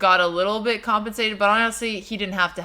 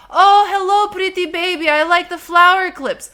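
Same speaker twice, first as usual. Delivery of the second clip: loud, high imitation of dad's Romanian accent; imitowany akcent taty; 9 semitones higher, 8 dB louder than usual. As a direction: gush like a friendly Romanian dad, accent put on. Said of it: "I'm trying to do your accent".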